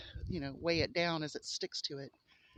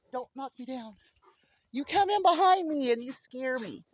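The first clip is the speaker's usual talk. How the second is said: pet talk; 6 semitones higher, 6 dB louder than usual. Real, not acted. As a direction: fondly scold a bouncy dog. Talking to her excited dog.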